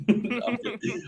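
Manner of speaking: soft, slow, laughing it off. Real, not acted. Backing off the joke, laughing softly. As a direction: laugh the threat away. Laughs after mock threat.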